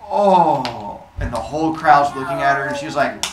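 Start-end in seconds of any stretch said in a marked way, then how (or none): 0.1-1.0 loud, drawn-out falling oh; dawning realization. Acted. Athena noticing herself mid-smashing, dawning embarrassed oh. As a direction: stop mid-swing and let it sink. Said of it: "she has that moment mid-smashing".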